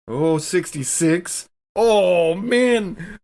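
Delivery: high, loud, laughing outburst of shock; can't believe the dice just killed her; shocked disbelief. Real, not acted. Player reacting to rolling instant death, shocked laughing disbelief.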